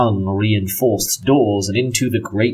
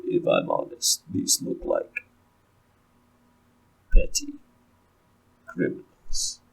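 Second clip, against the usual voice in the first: Ulfric's sneer; 5 dB quieter than usual. Acted. GM voices Ulfric, dismissive.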